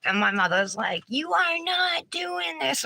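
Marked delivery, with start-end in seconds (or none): 1.1-2.8 higher and slow, laying down the law; mother putting her foot down; firm refusal. Retold or imitated. Mother forbidding her daughter from posing, slow higher firm voice.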